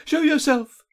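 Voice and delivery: high, loud command; insistent captive's loud challenge; demanding. A narrator's reading. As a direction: demand it sharply at high pitch. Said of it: "she demanded".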